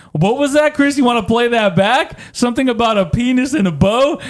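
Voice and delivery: lightly acted pointed questioning; questioning dad. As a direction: put him on the spot, mock-innocently. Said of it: "he goes".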